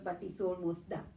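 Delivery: normal talking voice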